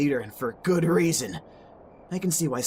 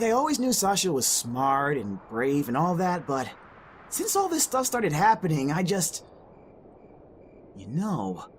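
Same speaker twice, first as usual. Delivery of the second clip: ordinary talk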